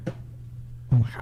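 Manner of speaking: breathy whispered oh my God; under the breath, flustered; whispered aside. Real, not acted. Flustered aside, losing his words.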